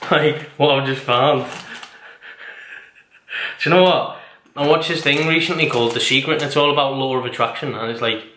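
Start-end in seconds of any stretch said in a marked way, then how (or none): 0.0-4.2 breathy laugh through the words. Real, not acted. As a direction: giggle it out. Laughing about found grapes.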